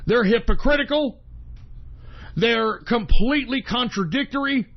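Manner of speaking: ordinary talk, nothing marked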